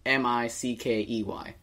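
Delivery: slow letter by letter spelling. Shown as a demonstration. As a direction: spell each letter slowly. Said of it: "How do you spell that?".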